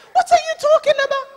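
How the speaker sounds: high, fast, loud retold wail; mock tearful protest. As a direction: imitate a crying man's bewildered protest. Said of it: "And he's crying".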